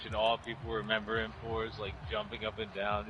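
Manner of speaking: normal talking voice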